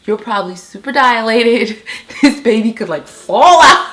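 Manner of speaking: giddy panic, laughing at her own thought. Retold or imitated. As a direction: laugh it out in rising disbelief.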